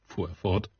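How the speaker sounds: plain reading voice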